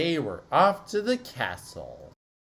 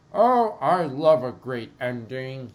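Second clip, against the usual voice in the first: character voice; 2 semitones higher, 5 dB louder than usual. Acted. Happy ending line.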